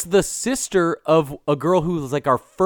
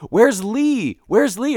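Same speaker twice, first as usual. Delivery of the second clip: higher-pitched imitation of people calling; acted searching question, raised pitch; mock searching call; 6 semitones higher, slower than usual. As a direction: call out for someone missing, pitch lifted. Acting characters searching for someone.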